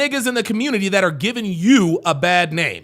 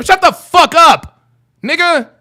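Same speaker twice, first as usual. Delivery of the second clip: ordinary delivery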